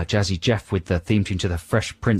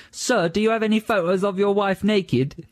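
high-pitched imitation of a pupil; cheeky schoolkid; 9 semitones higher than usual. Pupil asking the physics teacher, high cheeky kid voice. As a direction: put on a kid's voice, pitched right up. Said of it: "asked by one of the kids".

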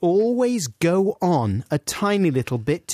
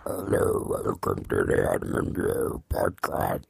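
deep pitch-shifted voice; voice changer; 4 dB quieter, slower than usual. Pretend. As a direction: talk through a voice changer, very low. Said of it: "I'm Princess Leia with a voice changer".

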